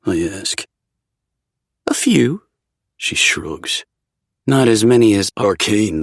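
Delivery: dry, teasing shopkeeper; shop witch voice. A narrator's reading. Jade's voice continues.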